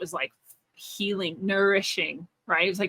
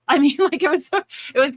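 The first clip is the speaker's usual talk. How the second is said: higher, louder laugh breaking through; 7 semitones higher, 5 dB louder, faster than usual. Real, not acted. Telling the listener about God, laughing higher and louder. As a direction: laugh out loud at the memory.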